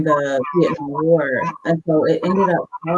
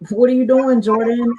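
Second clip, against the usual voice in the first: high, loud bark of a drill sergeant; drill sergeant yell; 8 semitones higher, 6 dB louder than usual. Retold or imitated. Drill sergeant shouting at an exhausted recruit, loud and high. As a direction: call her out sharply across the field.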